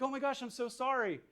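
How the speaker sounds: high, frantic overreacting apology; flustered, overwhelmed mother; stressed-out mom. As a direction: panic over the crying baby. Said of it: "super stressed out".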